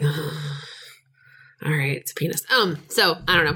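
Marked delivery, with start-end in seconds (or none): 0.0-2.4 unimpressed. Retold or imitated. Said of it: "was that a roll your eyes".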